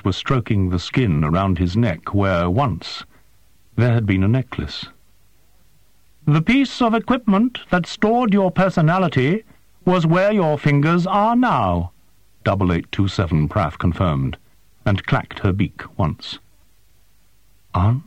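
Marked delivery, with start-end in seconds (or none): 6.2-11.9 high, precise voice of a small winged creature; formal, formal alien creature, pitched high. A narrator's reading. Creature Prath speaks.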